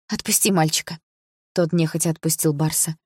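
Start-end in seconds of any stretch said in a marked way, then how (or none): none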